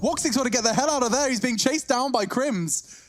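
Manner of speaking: excited high caster call; rising play-by-play hype; esports caster. Real, not acted.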